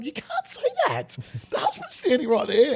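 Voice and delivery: high, laughing mock protest. MC protests the mother's wedding speech, laughing. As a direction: squeal it out through laughter.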